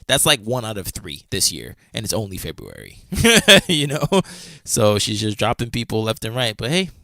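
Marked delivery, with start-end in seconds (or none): 3.0-3.6 loud, high-pitched laugh; burst of laughter. Real, not acted. Amused that it is only February, loud short laugh. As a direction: crack up briefly, high and loud.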